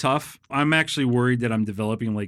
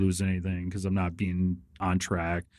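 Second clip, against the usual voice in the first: mopey retold answer; 5 semitones lower, 4 dB quieter than usual. Answering a friend asking about his weight loss, mildly dejected. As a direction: sigh it out like a man who has fallen off his diet.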